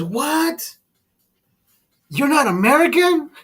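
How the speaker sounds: high, loud put-on outrage; mock disbelief. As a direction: gasp it out as a stunned clerk. Shocked clerk in retold video.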